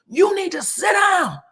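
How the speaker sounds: high, loud mama-style scream; screamed command. Retold or imitated. Nell screaming over to Destiny like her mama, high shout. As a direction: yell it like a mother ordering a child. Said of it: "scream over to her".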